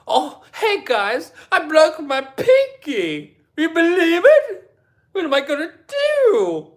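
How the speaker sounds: high sneering impression of a vlogger; mocking imitation. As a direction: ham up a whiny vlogger, laughing. Mocking Jason Park's vlog.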